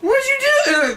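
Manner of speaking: put-on squeaky, high, tearful kid; scared child calling his dad; high boy's voice. Boy voiced in retold scene.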